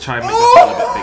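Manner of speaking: shrieking laughter. Real, not acted.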